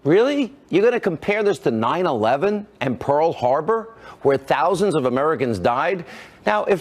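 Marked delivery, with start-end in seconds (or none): 0.0-2.7 high, rising disbelief; incredulous. Real, not acted. Host challenging a columnist's Pearl Harbor comparison, incredulous. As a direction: throw back an incredulous, high-pitched question.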